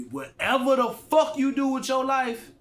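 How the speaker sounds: loud, high, drawn-out outburst; shouted, exasperated emphasis; emphatic rant. Real, not acted. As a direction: belt it out slowly to drive the point home. Ranting emphatically about putting in effort.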